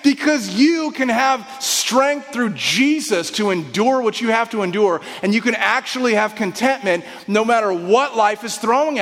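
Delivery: impassioned shout of correction; Paul insisting. Acted. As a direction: preach it full force as Paul. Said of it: "Paul's going".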